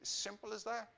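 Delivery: ordinary delivery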